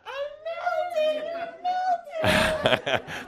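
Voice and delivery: high, wailing mock melting-witch shriek; witch melting scream. [name] answers the teacher with a melting-witch shriek. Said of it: "the bad witch in the Wizard of Oz screaming out".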